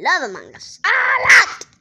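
loud wailing plea. Pretend. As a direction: beg the viewers loudly.

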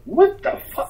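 outraged high-pitched disbelief; mock outrage. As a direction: squeak out the price shock. Outraged at bar price.

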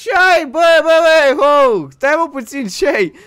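high, loud pleading; loud high frantic calls; panicked shouting. Acted. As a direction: shout to stop him in alarm. Pleading friend not to jump.

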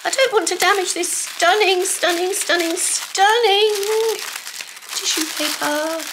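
gushing. Real, not acted. Admiring the tissue paper, high and gushing. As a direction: swoon over each stunning.

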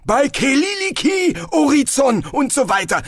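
flustered wizard racing the oath; rushed invocation. A narrator's reading. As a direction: rattle off the spell names. Rushed ritual formula.

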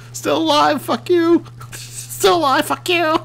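high-pitched squealed taunt; gleeful gloating. Pretend. Player gloats that his machine survived, gleeful. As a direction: taunt them in a giddy high voice.

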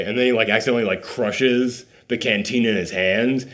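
ordinary delivery

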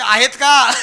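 high, loud questioning call; calling back. Acted. Tall wife checking her short husband follows, loud call. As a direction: call out to someone behind you.

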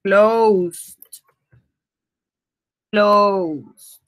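"Closed" said twice with a crisp final d; teacher drills "Closed"; modelled word. Shown as a demonstration. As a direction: repeat the past form to drill it. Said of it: "lo ponemos acá".

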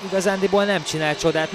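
excited hungarian sports commentary. Real, not acted. Goal call in hockey highlights.

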